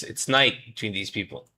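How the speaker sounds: normal talking voice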